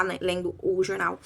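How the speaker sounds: ordinary talk, nothing marked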